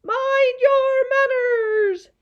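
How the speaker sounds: very high, drawn-out screech; angry scream. A narrator's reading. Bird disturbed by the shrieking mob, screaming angrily. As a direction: screech it slowly at full volume. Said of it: "in an angry voice".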